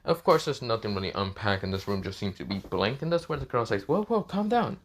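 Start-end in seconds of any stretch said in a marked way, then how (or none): 3.9-4.8 alarmed. Retold or imitated. Girl calming startled Naruto.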